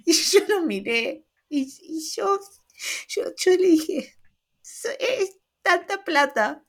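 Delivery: mock exasperation; flustered. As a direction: sputter it out in disbelief. Exasperated at forgetful cashier.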